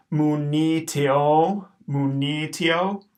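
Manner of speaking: slow, careful Mu ni tio with a long O; long O in tio drawn out; syllable demo. Shown as a demonstration. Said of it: "We got a long O".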